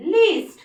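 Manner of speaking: teacher naming the word लिस्ट; model word. Shown as a demonstration. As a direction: pop out लिस्ट like a flashcard. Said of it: "सारे pronunciation long ई".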